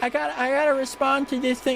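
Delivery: high mock mom voice; imitating mom. Streamer imitating her mom busy on the phone. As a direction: mimic mom fussing over her phone. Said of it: "She's like".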